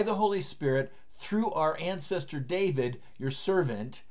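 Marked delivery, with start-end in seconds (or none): none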